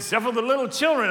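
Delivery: loud playful misquote; loud joking misquote. Shown as a demonstration. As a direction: belt out the wrong verse as a joke. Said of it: "let's try that again".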